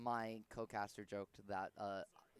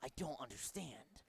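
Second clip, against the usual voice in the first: Snake impression; 4 dB quieter than usual. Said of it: "I do a Snake impression".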